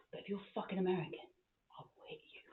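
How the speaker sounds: hushed menacing aside, trailing to whisper; imitated dad's soft warning; quiet threat. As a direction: drop to a near-whisper to threaten.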